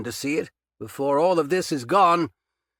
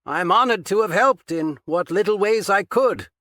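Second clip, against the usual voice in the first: strained; 9 semitones higher, 5 dB louder than usual. A narrator's reading. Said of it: "Patchen's reply sounded strained".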